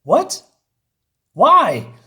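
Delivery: high, loud yelp of bafflement; loud, incredulous squawk; exasperated disbelief. Real, not acted. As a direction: cry out sky-high in disbelief. Exasperated reaction to the script.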